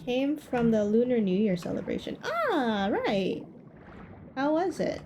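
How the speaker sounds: ordinary talk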